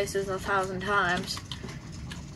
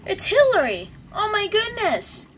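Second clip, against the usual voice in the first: mock alarm; 10 semitones higher, 4 dB louder than usual. Mock panic at a mob.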